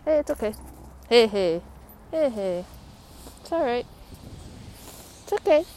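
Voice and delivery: loud, high calls to settle the dog; calm her down from a distance, loudly; loud soothing. Real, not acted. Owner loudly calming her growling dog, loud reassurance.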